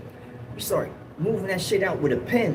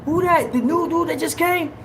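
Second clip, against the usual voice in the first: officer calling out; 14 semitones higher, 3 dB louder than usual. Retold or imitated. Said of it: "They was like".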